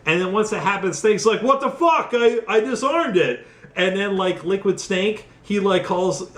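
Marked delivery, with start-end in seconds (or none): 1.4-3.5 higher-pitched baffled outburst; mock bewilderment. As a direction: voice a stunned game hero, pitched up. Voicing Snake's shock in retelling.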